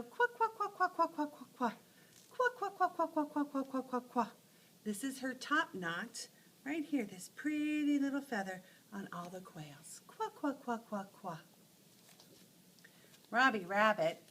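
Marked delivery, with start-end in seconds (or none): 0.0-4.3 high, chirpy quacking; baby bird quacks. Acted. Baby quails following mommy. 10.1-11.4 little quacks. Acted. Baby quails quacking again.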